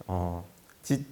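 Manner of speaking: ordinary talk, nothing marked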